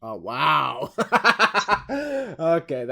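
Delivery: laughing okay after a burn. Real, not acted. Co-host laughs after being called Snitsky, laughing. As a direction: laugh it off, caught out. Reacting to a roast.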